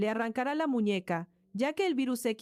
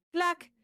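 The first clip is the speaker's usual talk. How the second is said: a bright, high clack, said much higher; high clack sound effect; 8 semitones higher than usual. Acted. Narrator voicing the door lock, a high clack. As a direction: voice the lock snapping open up high. Heavy armored door clicks open.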